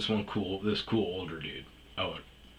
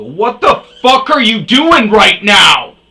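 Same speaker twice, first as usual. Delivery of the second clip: slow, much higher, full-throated yell; 13 semitones higher, 21 dB louder, slower than usual. Retold or imitated. Narrator confronting the rude old guy on the patio, yelling. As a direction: bellow it across the patio at him. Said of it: "It was more yelling".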